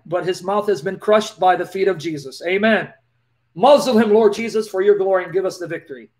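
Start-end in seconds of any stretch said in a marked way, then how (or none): none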